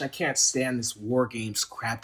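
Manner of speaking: usual speaking voice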